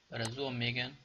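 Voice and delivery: normal talking voice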